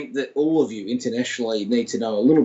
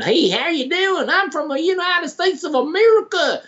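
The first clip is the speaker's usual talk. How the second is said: put-on American accent; 14 semitones higher than usual. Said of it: "Linda Kozlowski, you know, came in".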